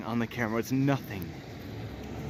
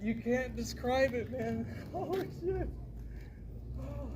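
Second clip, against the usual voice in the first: awed hush; 9 semitones higher, 15 dB quieter than usual. Real, not acted.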